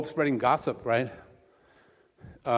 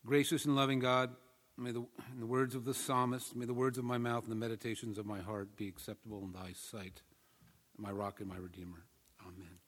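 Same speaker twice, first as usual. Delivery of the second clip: prayer; 11 dB quieter than usual. Real, not acted. Pastor asking that his words be acceptable, quiet prayer. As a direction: lead a quiet prayer, sinking softer to the amen.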